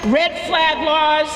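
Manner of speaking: ordinary delivery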